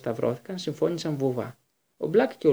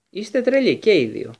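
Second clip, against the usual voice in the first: raised, higher voice thrown out at both men; the boy snaps back, louder and higher; outraged outburst; 6 semitones higher, 7 dB louder than usual. A narrator's reading. (εξαγριωμένο ξέσπασμα, πιο δυνατά και ψηλά)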